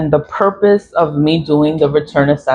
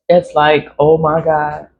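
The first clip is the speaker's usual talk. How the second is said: usual speaking voice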